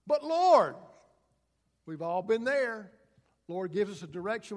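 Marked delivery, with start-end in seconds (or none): 0.1-0.8 a loud, high outcry; loud protest. Acted. Ananias protesting to the Lord, loud outcry. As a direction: burst out in protest, much higher and louder.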